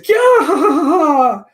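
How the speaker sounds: villain bursting onto the scene with a yell; triumphant shout. Acted.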